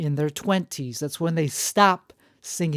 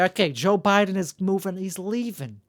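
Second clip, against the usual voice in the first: ordinary talk, nothing marked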